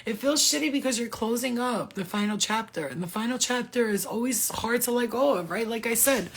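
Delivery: normal talking voice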